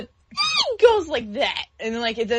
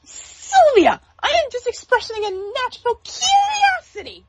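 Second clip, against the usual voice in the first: much higher, squeaky Wander voice; Wander impression; 13 semitones higher than usual. Friend doing Wander's high squeaky voice. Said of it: "That's Wanda's voice".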